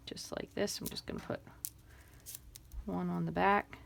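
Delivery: almost silent unvoiced whisper. Real, not acted. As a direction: mutter it to yourself as you work. Muttering aside while gluing.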